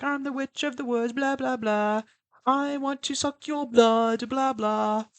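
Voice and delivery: put-on high, slow eerie little girl voice; high sinister child singsong; creepy kid song. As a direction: chant it like a haunted child. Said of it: "doing little kid songs".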